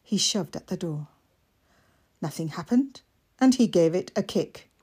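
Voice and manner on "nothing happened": usual speaking voice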